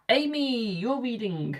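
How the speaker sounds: high, drawn-out call by name. Real, not acted. Calling the next person's reading.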